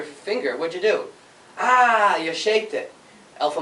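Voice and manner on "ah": higher, louder mock ouch; mock pain cry. Showing students a stubbed finger, mock ouch cry. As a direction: yelp as if you stubbed a finger.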